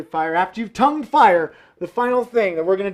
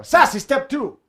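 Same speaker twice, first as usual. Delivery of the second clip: sassy call; 6 semitones higher, 3 dB louder than usual. Pretend. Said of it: "The sassy way".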